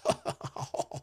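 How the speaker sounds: soft breathy scoffing laugh; imitated sneer laugh; mocking snicker. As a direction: snicker dismissively under the breath. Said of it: "they always laugh".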